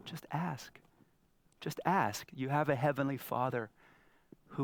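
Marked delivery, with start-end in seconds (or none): 0.1-2.3 much softer, tender just ask; gentle whisper of the Spirit. Acted. Holy Spirit softly inviting the listener, hushed. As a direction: breathe it softly like a loving invitation. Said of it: "Holy Spirit's looking at you".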